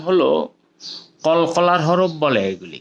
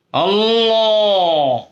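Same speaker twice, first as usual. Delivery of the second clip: full-mouthed call; 5 semitones higher, much slower than usual. Shown as a demonstration. Teacher demonstrating full-mouthed Allah, long and swelling. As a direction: fill your chest and stretch Allah wide. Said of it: "ফুল মাউথে আল্লাহ বলতে হবে".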